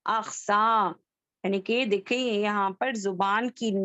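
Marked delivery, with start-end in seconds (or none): 0.0-1.0 अक्सा said slowly, deep from the back of the tongue; letter demo. Shown as a demonstration. Teacher sounding अक्सा for her students. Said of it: "अक्सर लिसान से अदा होने वाला".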